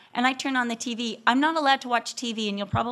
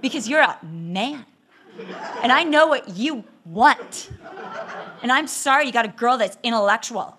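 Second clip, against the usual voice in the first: mock sarcastic jab; 5 dB louder than usual. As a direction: needle him with exaggerated, pointed emphasis. Retold jealous wife snipes at husband.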